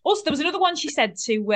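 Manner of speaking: ordinary talk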